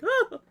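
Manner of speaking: high, loud mock shriek; imitating a scream. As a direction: shriek like a startled viewer. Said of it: "she's just like".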